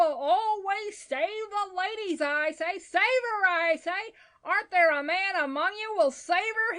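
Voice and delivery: high, loud, urgent pleading; rally a crowd to a rescue; increasingly excited cry. A narrator's reading. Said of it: "he cried in a state of increasing excitement".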